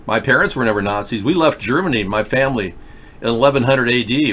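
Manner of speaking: usual speaking voice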